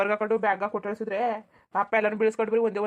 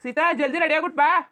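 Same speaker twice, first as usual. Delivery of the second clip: higher, louder shout for her to hurry; impatient call; 8 semitones higher, 9 dB louder than usual. Acted. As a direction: yell across the house to rush her. Impatient brother calls sister.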